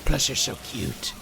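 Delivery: low, odd-voiced murmur of affection; weird cooing. Player sweet-talks the creature, weird low cooing. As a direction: coo it low in a slightly creepy voice. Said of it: "That did sound a little bit weird".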